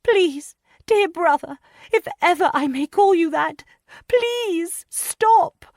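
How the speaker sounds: very high, loud pleading cry; a young wife crying out for her husband; crying woman's plea. A narrator's reading. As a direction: beg through tears in a high woman's voice. Wife begs brother-in-law.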